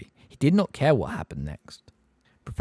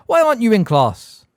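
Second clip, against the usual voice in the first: loud, fast, high sharp demand; teacher snapping at a pupil in the hall; snapped; 12 semitones higher, 10 dB louder than usual. A narrator's reading. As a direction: bite the question off. McGonagall scolding.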